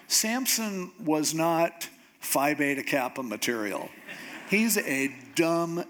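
ordinary delivery

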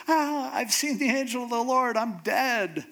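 high-pitched, hammed-up alarm; imitating a terrified man; mock fear. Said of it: "now he's afraid because he goes".

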